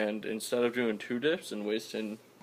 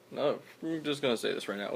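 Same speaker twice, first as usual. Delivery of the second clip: ordinary talk, nothing marked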